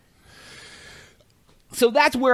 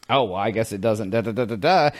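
low, understated passive delivery; low-key, unconfrontational murmur; 11 semitones lower than usual. Shown as a demonstration. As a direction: say it mildly, pitched well down. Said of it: "there's passive ways to say stuff".

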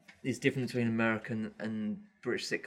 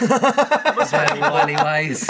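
mock gangster; 2 semitones higher, 11 dB louder, much slower than usual. Mock mafia banter.

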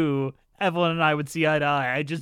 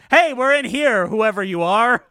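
loud, higher-pitched holler; raised voice hailing someone unseen; calling out; 4 semitones higher, 7 dB louder than usual. Acted. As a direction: call through to strangers beyond the door.

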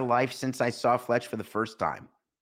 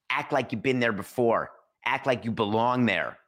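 ordinary delivery

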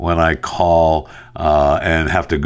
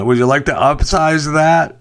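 upselling cashier; 9 semitones higher than usual. Retold or imitated. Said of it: "attempt to upsell customers".

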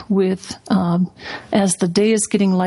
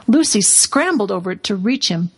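ordinary delivery, nothing marked

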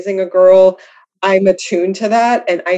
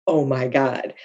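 low, hushed aside of disbelief; stunned murmur; 7 semitones lower, 8 dB quieter than usual. Retold or imitated. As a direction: drop right down, softly stunned. Stunned reaction to group mates reconciling.

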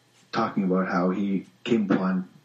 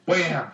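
punch sound effect; 7 semitones higher than usual. Pretend.

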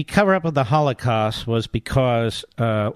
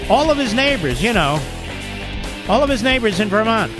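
mocking, raised sarcastic pitch; 8 semitones higher than usual. Real, not acted. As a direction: lay on the sarcasm thick. Sarcastic mock praise of Bernie.

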